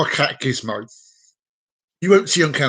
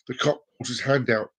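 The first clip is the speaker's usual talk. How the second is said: ordinary talk, nothing marked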